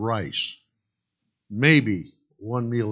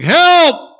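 high, loud cries for help; desperate yelling; 13 dB louder, slower than usual. Acted. Jack hanging off a cliff yelling for help, desperate shouts. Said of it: "Jack starts yelling".

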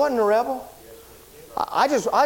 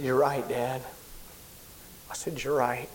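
defeated; 7 semitones lower, 9 dB quieter than usual. Retold or imitated. Admitting to father, defeated.

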